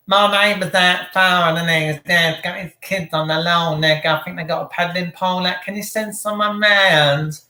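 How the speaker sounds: mocking whiny tattletale on the phone; snitching neighbour. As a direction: imitate a busybody reporting the neighbours, dragged out at the end. Mocking a neighbour phoning police.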